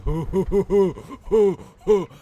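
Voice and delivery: high, loud, repeated hoots; mindless hooting. Acted. Mocking how Defender players just sit there, mindless hooting. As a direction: hoot away like someone on easy mode. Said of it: "just sit there and go".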